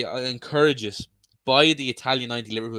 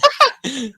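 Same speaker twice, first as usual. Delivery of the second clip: high laugh; 13 semitones higher, 7 dB louder than usual. Real, not acted. Laughing at mukbang joke.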